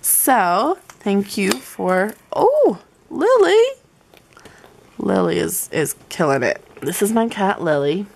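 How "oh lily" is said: high cooing. Real, not acted. Cat interrupts.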